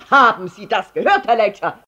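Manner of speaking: laut angeherrscht, etwas höher; brüllend. A narrator's reading. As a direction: den Nachbarn anbrüllen. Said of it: "brüllte er Herrn Letscher an". (lautes, strenges Brüllen)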